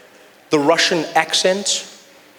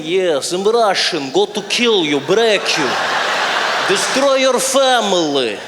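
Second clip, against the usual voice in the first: put-on slow, heavy Russian accent; hammed-up dangerous Russian; menacing Russian accent; slower than usual. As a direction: threaten slowly in a thick Russian accent. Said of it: "sounds dangerous and menacing".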